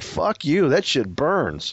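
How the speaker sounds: normal talking voice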